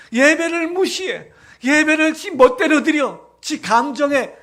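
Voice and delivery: loud, high, driving sermon voice, slowing on the hammered phrases; preaching at full force. Acted. Pastor rebukes the congregation for neglecting worship, preaching at full force. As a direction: thunder it from the pulpit, pressing each clause harder.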